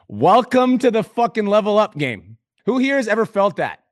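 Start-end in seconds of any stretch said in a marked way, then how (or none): 0.1-2.4 high, slow, punchy announcement; hyped welcome. Real, not acted. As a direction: welcome them in like a hype host.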